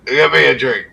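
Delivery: put-on loud high drunken call. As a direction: holler for the innkeeper demandingly. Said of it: "And kinda calls out".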